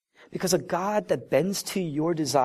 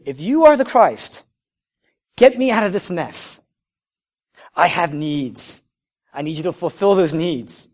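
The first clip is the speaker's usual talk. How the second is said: loud, raised-pitch demand in emphatic bursts; demanding criminal; 3 semitones higher, 8 dB louder than usual. Acted. Criminal demands rescue from Christ, loud insistent demand. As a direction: push it out as an insistent, acted plea. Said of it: "In other words, what he's saying is".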